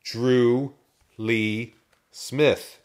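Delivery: Drew... Lee... Smith, one word at a time; loud, separated words in anger; angry, very slow. A narrator's reading. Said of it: "Taking one word at a time, he spoke very slowly".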